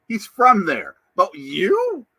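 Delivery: high, loud mock incredulity; teasing disbelief. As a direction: question a buddy in exaggerated disbelief. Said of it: "I'm like".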